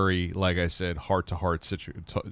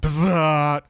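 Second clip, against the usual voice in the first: loud, high, drawn-out electric zap noise; imitated stove buzz; 7 semitones higher, 9 dB louder than usual. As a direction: buzz like a shorting appliance. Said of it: "that's what it sounded like".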